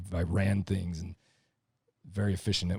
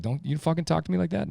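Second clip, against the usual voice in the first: higher, indignant snap; indignant retort; 10 semitones higher than usual. Retold or imitated. Lineman snapping back at his wife, indignant. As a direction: bristle at being told what to do. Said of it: "Now you're like".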